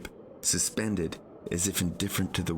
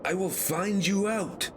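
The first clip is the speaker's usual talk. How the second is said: high, far-carrying yell; 13 semitones higher than usual. Acted. Boy yells after the departing balloon, high. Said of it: "I yelled".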